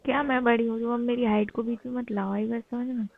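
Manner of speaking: usual speaking voice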